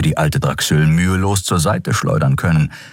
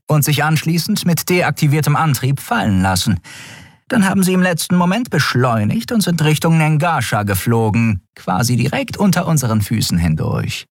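high-pitched officer's report voice; dutiful first officer; 9 semitones higher than usual. A narrator's reading. Shia reporting to the admiral, dutiful. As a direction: report it formally in a higher character voice.

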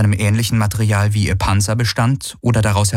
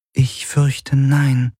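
higher-pitched, mildly sheepish answer; slightly ashamed; 5 semitones higher, much slower than usual. A narrator's reading. Artificial helper admitting it doesn't know them, slightly ashamed. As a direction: admit it with a touch of embarrassment. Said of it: "leicht beschämt".

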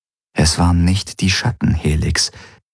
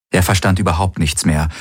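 ordinary delivery

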